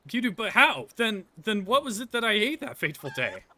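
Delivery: high, loud disbelieving cry; shocked. Acted. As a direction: gasp it out in horror. Shocked cry over the chicken.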